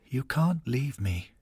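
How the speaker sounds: a touch of tearful pleading, slow; holding back tears. A narrator's reading. Wife at her dying husband's bedside, mildly tearful. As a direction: plead softly, fighting tears. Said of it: "tries and fails to hide her tears".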